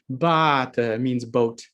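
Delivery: bata with a long, stressed first A, said slowly. Shown as a demonstration. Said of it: "it has an A with a stress mark".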